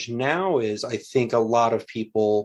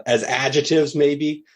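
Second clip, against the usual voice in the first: ordinary talk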